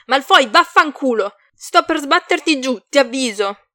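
heated, high-energy shouting; furious threat. A narrator's reading. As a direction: swear and warn him off forcefully. Swears, threatens to hang up.

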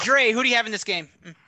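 ordinary talk, nothing marked

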